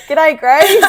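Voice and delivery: hammed-up Australian g'day. Shown as a demonstration. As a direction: try an Australian greeting, badly, laughing. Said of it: "could never get away with g'day".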